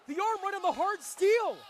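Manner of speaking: high, loud excited cry; alarmed shout. Real, not acted. Commentator seeing an arm hit steel, alarmed shout. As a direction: yell it in shock.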